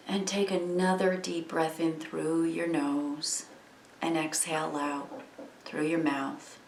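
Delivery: soft, unhurried meditation voice with long pauses; guided meditation. Acted. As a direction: gently guide listeners into breathing and letting go.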